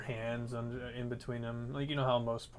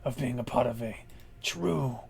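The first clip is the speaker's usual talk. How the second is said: loud, gruff orc snarl; gruffer voice; 5 dB louder than usual. Acted. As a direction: snap at them like a brute boss. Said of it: "You hear a gruffer voice".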